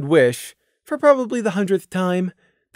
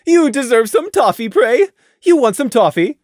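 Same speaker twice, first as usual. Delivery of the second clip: louder, overeager mare; guilty Taffy; 6 semitones higher, 5 dB louder than usual. A narrator's reading. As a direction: hide the guilt badly. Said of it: "asked with badly concealed guilt".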